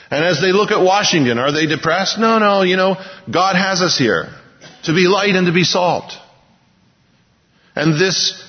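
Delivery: impassioned pulpit appeal; fervent preaching. Acted. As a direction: press the point to the congregation. Preaches passionately to congregation.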